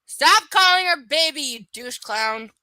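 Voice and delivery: loud, high outburst at the book; exasperated shout. Real, not acted. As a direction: yell at the character, fed up. Reader yells at book character.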